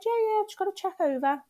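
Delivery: high, bright mock nurse reassurance; breezy nurse voice. Imitating the nurse's reply.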